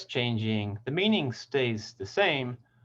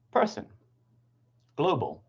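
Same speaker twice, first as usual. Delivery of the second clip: "person, global", each stressed on its first syllable; "person, global" as separate examples, strong first syllables; 5 semitones higher than usual. Shown as a demonstration. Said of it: "first syllable stress".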